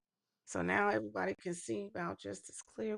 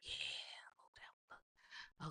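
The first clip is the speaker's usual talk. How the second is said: whispering; 12 dB quieter, much slower than usual. Real, not acted. Reacts to crime footage.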